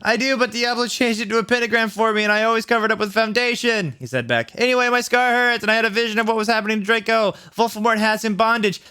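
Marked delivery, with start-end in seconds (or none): 0.0-3.9 high-pitched, hammed-up shouting back; frantic reply. A narrator's reading. 4.5-8.8 high-pitched, hammed-up shouting back; frantic reply. A narrator's reading. Frantic character reporting a vision.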